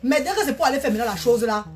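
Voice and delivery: ordinary delivery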